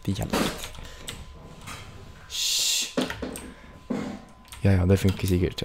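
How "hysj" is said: soft unvoiced hush; a whispered hush; shushing. Real, not acted. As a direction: shush someone nearby. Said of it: "Jeg hysjer på noe som bråker".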